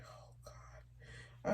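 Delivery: breathless whisper of distress; whispered Oh, God. Real, not acted. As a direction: let it slip out as a whisper. Overwhelmed while reading her list.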